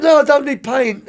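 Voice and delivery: high, loud protest; loud refusal. Retold or imitated. Driver turning down the coach's tip, loud and high. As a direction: wave the money away with a shout.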